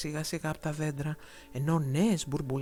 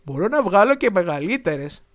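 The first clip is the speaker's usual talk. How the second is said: louder boastful dragon; proud dragon; 10 dB louder than usual. A narrator's reading. Dragon boasting to his new friend, louder. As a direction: show off a little. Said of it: "απάντησε ο δράκος".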